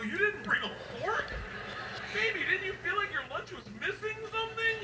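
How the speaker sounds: high singsong scolding dad; mock sweet dad. Dad gently chiding daughter over missing fork.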